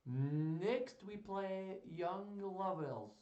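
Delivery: slow, hammed-up French accent. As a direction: recite grandly in a shaky attempt at French accent. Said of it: "get through this in a French accent".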